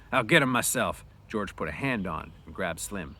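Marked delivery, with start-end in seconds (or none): 0.1-1.0 louder, determined threat; angry cry. Acted. Slim ready to fight Curly, angry cry. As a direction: threaten to step in yourself. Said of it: "he cried".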